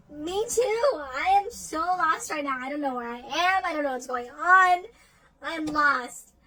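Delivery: high and loud, lost and frightened; panicked inner cry. Retold or imitated. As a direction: blurt out that you're completely lost. Panicked inner thought in dream.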